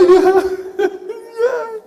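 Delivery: high laugh that cracks into a sob; real high laugh tipping into crying; laugh turning to a cry. Real, not acted. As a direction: let the laugh break toward tears. Laughing remembering his tears.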